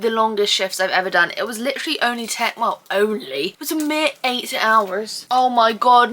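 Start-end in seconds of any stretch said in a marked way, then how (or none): none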